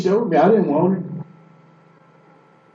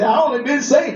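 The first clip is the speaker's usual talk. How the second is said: stunned disbelief; 7 semitones higher than usual. Shown as a demonstration. Telling the church how he answered the pastor, in disbelief. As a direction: say it like you can't believe it. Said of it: "That's how I said it, just like that".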